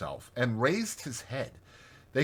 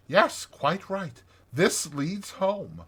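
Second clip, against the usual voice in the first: slow, higher, gently certain inner voice; inner voice of instinct; 6 semitones higher, slower than usual. A narrator's reading. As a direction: let the instinct speak slowly and surely. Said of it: "saying unmistakably".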